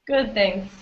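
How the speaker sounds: ordinary delivery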